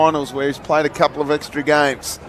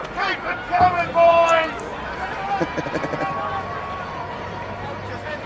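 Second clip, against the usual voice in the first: delighted high laugh; 13 semitones higher, 8 dB quieter than usual. Real, not acted. Laugh after the goal call.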